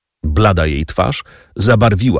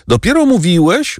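usual speaking voice

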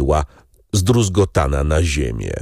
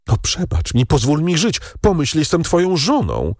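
higher, rising desperate plea; begging for life; 9 semitones higher than usual. Acted. Wife pleading with prosecutor husband for her life.

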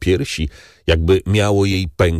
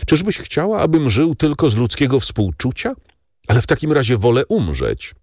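pained lover; 7 semitones higher than usual. Acted. Morel protests to Valentin.

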